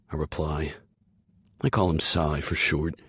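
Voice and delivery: plain reading voice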